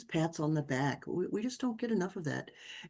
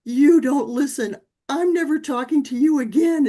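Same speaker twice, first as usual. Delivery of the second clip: much louder outburst, high and dragged out; child's angry shout; 8 semitones higher, 11 dB louder than usual. Retold or imitated. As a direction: shout it like a furious ten-year-old. Angry son shouting at mother.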